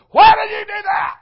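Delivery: high, loud mock yell at a kid; coach hollering. Coach yelling at a kid who threw the ball away, loud mock yell. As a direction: bark it like an angry Little League coach. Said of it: "they're hollering and stuff".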